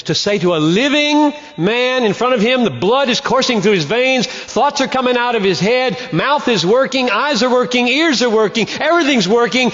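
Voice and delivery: preaching, pitch lifted, pushing each item; rising fervor. Acted. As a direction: build the list with mounting energy. Preacher building a list.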